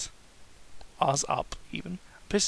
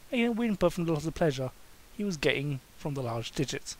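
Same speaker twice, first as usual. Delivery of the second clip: plain reading voice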